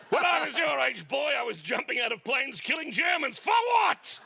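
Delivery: put-on high, loud outraged old soldier; ranting veteran dad. Dad points machete at son, high loud outraged rant.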